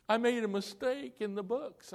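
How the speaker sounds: imitated high-pitched tearful confession; tearful secretary. Secretary confesses an error to pastor, imitated tearful voice. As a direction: mimic an upset woman owning up. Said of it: "and she's crying again".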